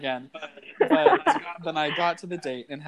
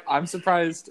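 usual speaking voice

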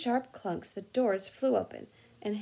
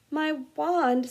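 shaky voice; 6 semitones higher, 7 dB louder than usual. Acted. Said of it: "said Ron in a shaky voice".

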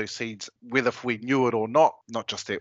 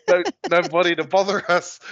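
high, loud, laughing through the words; laughing retort; 7 semitones higher, 6 dB louder than usual. Retold or imitated. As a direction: crack up while quoting your own excuse. Laughs through his retold reply.

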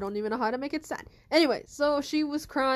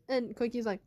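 usual speaking voice

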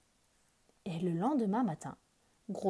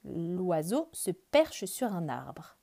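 usual speaking voice

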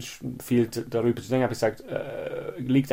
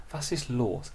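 soft, quieter, talking down; mock the colleague's soothing concern; patronising tone; 6 dB quieter, slower than usual. [name] imitates his colleague's patronising question softly. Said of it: "zuerst dieses Ton".

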